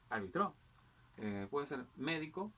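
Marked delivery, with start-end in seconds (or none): 0.1-0.5 arbitró said ar-bi-TRÓ; stress on last syllable. Shown as a demonstration. Teacher varying the accent for students, arbitró stressed on TRÓ. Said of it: "el acento puede variar". 2.0-2.5 médico said MÉ-di-co; stress on first syllable. Shown as a demonstration. Teacher giving accent examples, médico stressed on MÉ. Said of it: "el acento puede variar".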